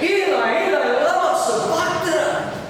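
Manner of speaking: a preacher's high-pitched cry from the cross. Acted. Preacher voicing Jesus on the cross, crying out. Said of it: "cried out with a loud voice".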